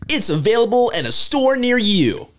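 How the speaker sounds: high, bouncy cartoon ad voice; kids' commercial voice. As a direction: pitch cereal to kids. Kids cereal commercial impression.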